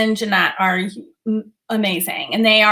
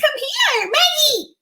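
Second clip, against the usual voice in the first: squeaky-high pet talk; calling the dog. Real, not acted. Calling her dog onto lap.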